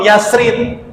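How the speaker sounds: "Yasrib" with a plain s, as Yas-rit; imitated mispronunciation. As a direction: mimic the contestant's "Yasrib", final b lost. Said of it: "Tadi dia jawab".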